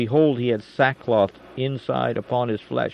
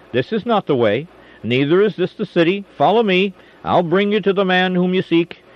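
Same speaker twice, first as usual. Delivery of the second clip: louder and higher, rising as he calls them on; commanding call; 7 semitones higher, 6 dB louder than usual. A narrator's reading. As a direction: call out boldly to lead the army astray. Elisha leads blinded army away.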